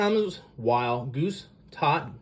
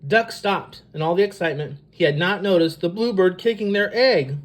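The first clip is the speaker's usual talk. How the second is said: usual speaking voice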